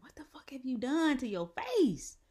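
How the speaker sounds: high, loud mock outrage; imitated mom. Mom reacting to child's face on FaceTime, imitated yell. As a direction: imitate a mom yelling in disbelief. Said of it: "this is how my mom talks".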